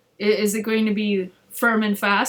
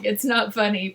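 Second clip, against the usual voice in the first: normal talking voice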